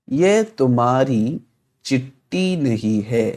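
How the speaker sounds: very slow, word by word; slow model reading. Shown as a demonstration. Teacher to students, slow word by word reading. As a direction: lay out each word slowly for learners. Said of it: "teacher models Urdu sentence".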